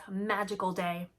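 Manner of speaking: ordinary delivery, nothing marked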